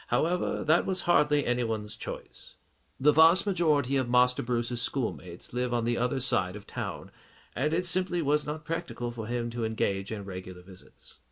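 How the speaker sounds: a touch of the loyal English butler; lightly voiced butler.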